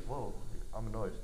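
low, very soft grumble; mock annoyed. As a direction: imitate someone quietly put off. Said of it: "sometimes they get annoyed".

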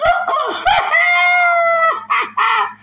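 high shrieking burst of laughter; loud laugh. Real, not acted. Laughing loud at a fake Southern accent.

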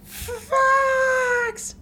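very high falsetto wail; Slippy. Acted. Captive Slippy calls for Fox, shrieked falsetto. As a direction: stretch it into a hammed-up squealing cry for help.

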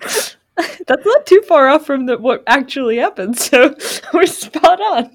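words squeezed out through real laughter, slowed; giggling so hard it almost breaks; laughing. Real, not acted. Retelling what happened, laughing it out. Laughing about the question's origin.

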